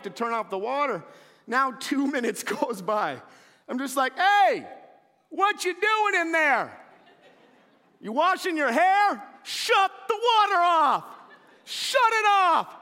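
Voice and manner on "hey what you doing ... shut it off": loud, high, drawn-out yell; husband yelling at the bathroom, slow and loud; letting her have it. Retold or imitated. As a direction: bellow it slowly from the couch, fed up. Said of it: "I'm just letting her have it".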